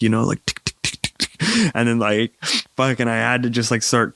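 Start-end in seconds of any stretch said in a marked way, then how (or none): 0.5-1.3 soft breathy tick sounds mimicking a knock; hushed mock knocking, trailing off; quiet knocking imitation. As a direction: tap it out under your breath like knuckles on a door. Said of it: "quietly trying to knock".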